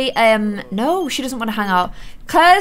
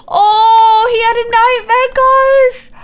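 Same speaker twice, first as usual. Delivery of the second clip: sympathetic cooing; 8 semitones higher, 3 dB louder, much slower than usual. Real, not acted. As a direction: coo with pity, pitched up. Baby Sim had nightmare.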